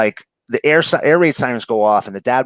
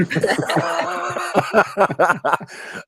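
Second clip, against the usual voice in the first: long high-pitched laugh; real laughter; 8 semitones higher than usual. Real, not acted. Speaker cracks up after joking about New York swearing, laughing.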